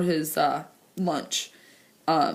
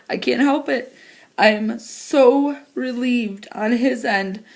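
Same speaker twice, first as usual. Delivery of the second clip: crying; 5 semitones higher, slower than usual. Real, not acted. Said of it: "I don't like crying on video".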